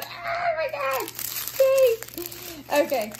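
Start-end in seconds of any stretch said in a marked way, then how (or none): 0.0-2.0 very high, loud delighted squeal; squealing joy. Real, not acted. Squealing over special drill.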